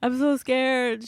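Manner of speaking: hammed-up frightened call to a friend; mock panic. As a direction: play up being scared to a friend. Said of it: "I'm like".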